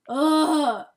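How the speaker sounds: loud exasperated ugh; fed-up ugh. Real, not acted. Ending the rant, a loud fed-up groan. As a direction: groan it out, done with it.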